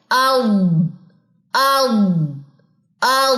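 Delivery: a long, open "आउ" demo; drawn-out aau. Shown as a demonstration. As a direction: stretch out "आउ" for the class. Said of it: "demonstrating the sound".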